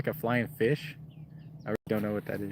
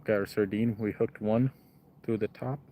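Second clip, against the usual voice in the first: ordinary talk, nothing marked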